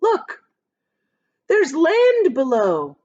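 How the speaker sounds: high, loud cry of discovery; shouted. A narrator's reading. Centipede shouting to friends on seeing land. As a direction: yell it out, thrilled.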